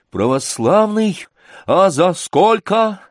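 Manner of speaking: high, slow mock wonder; taunting question. Сашка to Гундосый before the laughing tavern, taunting. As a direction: stretch out the question to needle him. Sashka taunts the bully back.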